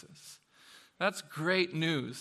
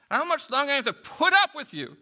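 higher and louder, fed-up cry; exasperated loud rebuke; 10 semitones higher, 10 dB louder, faster than usual. Acted. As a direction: let the frustration burst out. Jesus rebuke quoted again.

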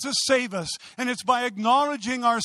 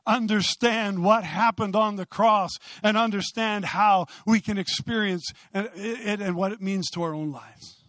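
usual speaking voice

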